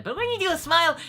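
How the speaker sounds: high, loud voice through a forced smile; Goku. Shown as a demonstration. Showing the trick to fans, smiling impish voice. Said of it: "force smile a little bit".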